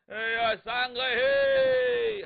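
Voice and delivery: loud high drawn-out wail; hammed-up funeral crying, stretched long and loud; fake mourning wail. Cheng putting on mourning for third brother, loud stagey wail. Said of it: "程咬金又哭起来了".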